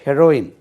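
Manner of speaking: loud ஹெரோயின், short "he" then "ro-in"; correct heroine pronunciation. Shown as a demonstration. Teacher drilling hero and its feminine, correct short-vowel he-ro-in. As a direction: say ஹெரோயின் firmly as he-RO-in, no long "hee". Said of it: "அதனுடைய உச்சரிப்பு ஹெரோயின் தான்".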